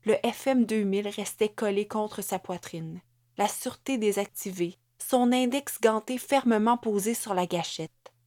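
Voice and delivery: plain reading voice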